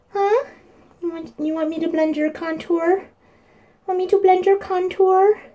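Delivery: very high singsong cooing. Real, not acted. Baby talk while playing.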